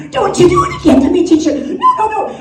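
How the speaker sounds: put-on fast, high-pitched childish protest; pleading little kid. Little Bobby begs teacher not to do it again, high frantic pleading. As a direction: beg like a panicking small pupil.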